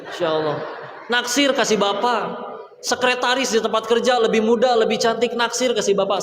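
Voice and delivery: voice of temptation. Acted. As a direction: tempt him like a giggling inner devil. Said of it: "hawa nafsuku berkata".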